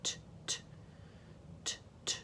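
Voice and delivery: very soft voiceless "t" taps; isolated T sound. Shown as a demonstration. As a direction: pairs of quiet "t" clicks, no vowel. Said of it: "begins with a T".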